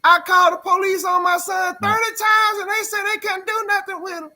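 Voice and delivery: high, loud cry of a mother who called for help; grieving mother. Retold or imitated. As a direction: shout it like a desperate mother on the news. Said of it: "What was that woman doing".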